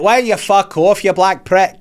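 acted angry South African; mock South African accent. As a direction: snap back in a put-on South African accent. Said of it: "He's like".

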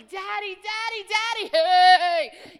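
put-on high, loud childlike Daddy calls; child calling daddy. Believer calling God like a child wanting attention, high and loud. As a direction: tug at Father's sleeve.

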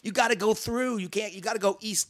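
high-pitched mock yell at players; heckling fan. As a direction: imitate a fan hollering from the stands. Mimicking lady heckling the players.

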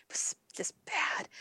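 whispered, conspiratorial aside; a hushed verdict. Real, not acted. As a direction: breathe it like a confession. Dismissive aside about Kraven.

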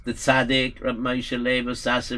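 plain reading voice

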